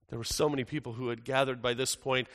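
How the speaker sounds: usual speaking voice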